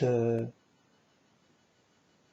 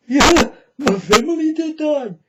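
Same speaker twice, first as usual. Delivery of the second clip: high, loud laughing mockery of a grinning mourner; cackling high sarcastic impression, loud and bright with laughter; mocking laugh; 13 semitones higher, 12 dB louder than usual. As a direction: laugh it out like a fake mourner who's glad. Mocks smiling bereaved camera people.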